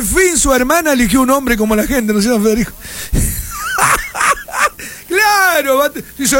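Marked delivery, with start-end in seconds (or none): 2.8-4.9 shrill burst of laughter; real laugh breaking out high. Real, not acted. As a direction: cackle high and long. Host cracks up on air.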